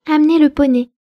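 high, loud imperious order; commanding. A narrator's reading. Spoiled girl commands the servant woman imperiously. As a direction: bark the order down at her. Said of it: "commanda-t-elle".

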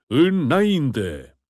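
battle cry. Acted. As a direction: call the men forward. (strigăt de luptă)

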